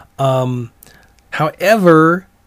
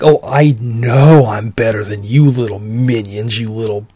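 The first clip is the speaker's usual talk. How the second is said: holier-than-thou; 4 semitones lower than usual. Retold or imitated. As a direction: mimic someone looking down on lesser folk. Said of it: "they had that holier than thou attitude".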